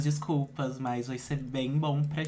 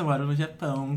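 usual speaking voice

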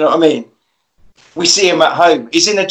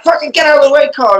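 high, louder hammed-up shout; mock panicked yell; 9 semitones higher, 3 dB louder, faster than usual. Ian shooting at the monster, high hammed-up panicked yell. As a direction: yell it while backing away firing.

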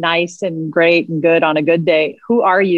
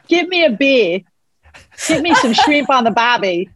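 hammed-up Australian, pitched up; Aussie accent; 6 semitones higher than usual. Guest trying out Aussie catchphrases, mock Australian accent. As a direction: put on a jokey Aussie voice. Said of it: "start talking in an Aussie accent".

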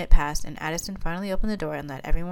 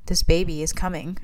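ordinary delivery

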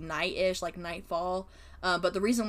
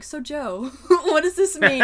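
higher, louder teasing question with a laugh in it; mocking friends; 9 semitones higher, 11 dB louder, slower than usual. Friends teasing the sculpture major about every artwork, mock-innocent and loud. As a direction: ham up the friends needling the art major. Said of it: "they would be like".

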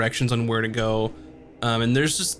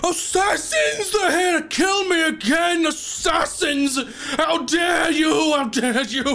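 much higher, slow, panicked screaming of a man who fears for his life; terrified shout. Acted. Prisoner sees the party and screams they are assassins, terrified high shout. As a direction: shriek it like the killers are at the bars. His eyes get wide.

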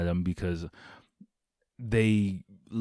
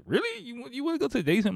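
incredulous driver; faster than usual. Retold or imitated.

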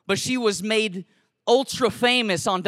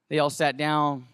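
ordinary delivery

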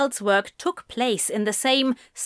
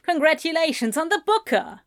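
host voice; 7 semitones higher, faster than usual. A narrator's reading. Host character voice.

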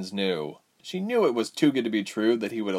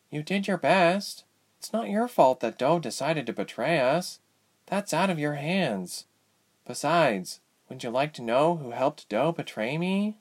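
high, measured character voice; consoling cellmate; 6 semitones higher than usual. Acted. Goose consoles Ilse from next cell, high consoling voice. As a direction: reassure in a higher-pitched voice.

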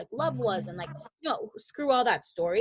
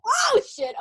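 much higher, louder startled yelp; startled self; 7 dB louder than usual. Retold or imitated. Startled by God telling her to shut up, high yelp. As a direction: yelp in surprise. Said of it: "I'm like".

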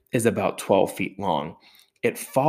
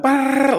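startled yelp; 6 dB louder than usual. Retold or imitated. Family panics at once.

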